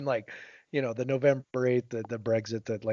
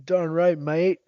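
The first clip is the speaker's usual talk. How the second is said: high-pitched mock Australian; Australian accent; 7 semitones higher, 4 dB louder, much slower than usual. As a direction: try out a perky Australian accent. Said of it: "is that Australian?".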